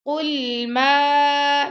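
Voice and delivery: قل ما with the ما stretched long; long meem. Shown as a demonstration. Teacher stretching the meem of قل ما for students. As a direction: hold the ما of قل ما. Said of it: "میم کو لمبا کریں گے".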